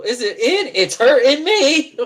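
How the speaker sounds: high, mock-pained voice; movie dude impression. Teasing friend with a film quote, high mock-pained impression. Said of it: "you sound like that dude off that movie".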